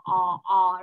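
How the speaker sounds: "o" said with rounded lips, long and open. Shown as a demonstration. Teacher shows the child a rounded o. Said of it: "tròn môi và o".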